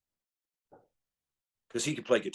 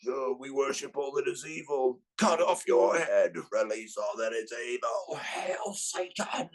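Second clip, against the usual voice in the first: mock evil speech; 5 semitones higher, slower than usual. Quoting Manowar end speech.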